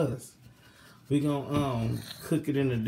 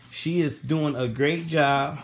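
ordinary talk, nothing marked

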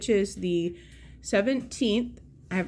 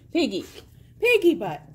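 high teasing pet name; 7 semitones higher, 6 dB louder than usual. Real, not acted. Teasing greedy dog.

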